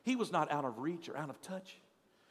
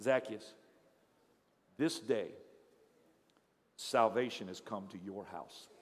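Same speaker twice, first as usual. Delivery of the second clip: calm pronouncement; 10 semitones lower than usual. Acted. Jesus looks at him and says.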